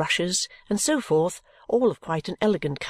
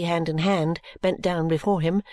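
usual speaking voice

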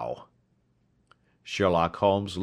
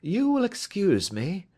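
higher, smooth and courteous; bland politeness; 12 semitones higher than usual. A narrator's reading. Detective approaching the startled little man, blandly polite. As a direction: mildly excuse an intrusion. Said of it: "said Holmes blandly".

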